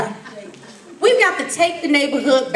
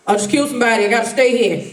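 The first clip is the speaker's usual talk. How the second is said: mock low killer voice; 6 semitones lower, faster than usual. Voicing killer in story.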